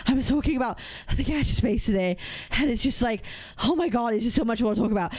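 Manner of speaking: puffing between phrases like a breathless walking streamer; mock panting. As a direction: pretend to be winded from climbing a hill. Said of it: "Do you wanna hear me panting".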